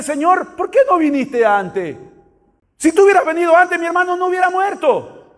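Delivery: high-pitched complaining reproach; reproche dolido. Acted. Marta reprocha a Jesús. (reclamando con voz aguda)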